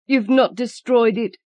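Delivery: high, loud anxious question; frightened she lost it. A narrator's reading. Anxious wife asks.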